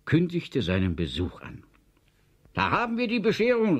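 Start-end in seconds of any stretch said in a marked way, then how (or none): 2.5-3.8 high, fast angry outburst; erbost. A narrator's reading. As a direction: cry it out in exasperation, louder and quicker. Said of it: "rief Herr Kurux erbost". (erboster Ausruf, hoch und schnell)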